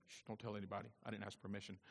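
hushed, low stage aside; soft, low conspiratorial murmur; mock confiding. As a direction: drop right down as if sharing a secret. Jokingly confides a secret to congregation.

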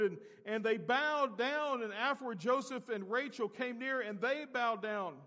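high, loud sermon delivery; full-force preaching. Real, not acted. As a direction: preach it out with fervor.

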